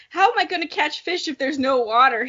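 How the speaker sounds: normal talking voice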